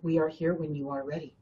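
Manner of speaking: gentle reassurance. Retold or imitated. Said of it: "is going".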